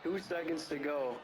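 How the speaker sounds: dramatic sportscaster wrap-up; play-by-play call. Acted. Gamer calling the game's final snap, dramatic. Announcer calls the last play.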